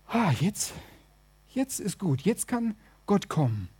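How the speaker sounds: softly acted sigh of relief; hushed, work-is-done relief; quiet relief. Tired cleaners finish, sit down.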